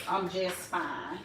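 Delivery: low, soft, slow family voice; quiet, slow mimic of family; brother and mama voice. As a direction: play your brother and mama saying it. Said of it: "in my brother and my mama voice".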